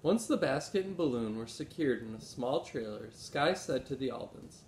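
ordinary delivery

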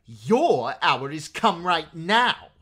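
high, very loud, drawn-out royal threat; angry retort. A narrator's reading. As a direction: shout the threat back at him, slowly. Boy king answers the defiant captive.

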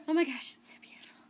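breathless whisper of delight; awed whisper. Real, not acted. Admiring the book to viewers, in an awed whisper. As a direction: whisper it like it's sacred. Gushing over the beautiful book.

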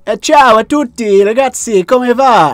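loud, high sing-out to a crowd; high-pitched, hearty Italian holler; exuberant Italian greeting. Pretend. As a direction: call out a big hello in show-off Italian. Acts greeting locals in Italy.

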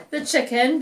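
ordinary delivery

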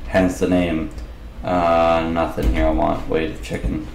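ordinary talk, nothing marked

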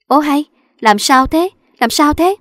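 she's frightened, asking what's wrong; alarmed wife. Acted. (vợ hốt hoảng hỏi, giọng cao và to)

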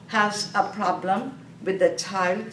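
ordinary talk

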